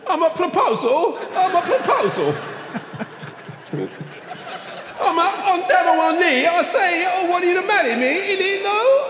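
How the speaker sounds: hammy squeaky Italian lover; Jared Leto Italian accent. Pretend. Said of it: "Do it as Jared Leto".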